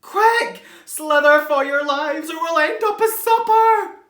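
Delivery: loud high frantic urging; shrill frantic warning; panicked. Acted. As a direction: hurry them in terror. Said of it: "in panic".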